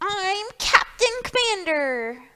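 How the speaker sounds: Small Pig wanting whales, high and eager; eager little pig. Acted. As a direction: squeal it like an excited small pig. Small Pig voiced in story.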